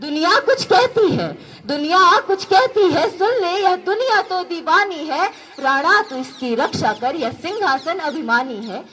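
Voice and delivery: rousing heroic verse with rising and falling lines; poem recitation. Acted. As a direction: recite it like a stirring ode to Rana Pratap. (वीर रस का जोशीला कविता पाठ)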